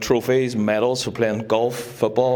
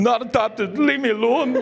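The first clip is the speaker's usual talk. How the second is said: high-pitched wail of a tormented kid, played for laughs; mock scream; 11 semitones higher than usual. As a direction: imitate your childhood self screaming.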